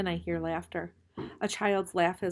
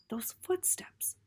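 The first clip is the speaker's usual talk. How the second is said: whisper; 9 semitones higher, 4 dB quieter, slower than usual. Acted. Urgent whisper in dark.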